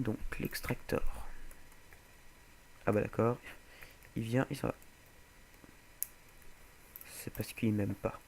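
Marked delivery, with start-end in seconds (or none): none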